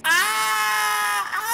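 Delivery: long, high scream; acted scream. Someone screaming outside in the storm, long high scream. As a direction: hold a high scream of alarm. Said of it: "Someone just screamed".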